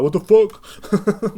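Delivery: high, loud, slow outraged what the fuck; offended teacher. Retold or imitated. Male teacher hearing the podcast, loud slow outraged gasp. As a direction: stretch it out, scandalized. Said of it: "male teacher listening around like".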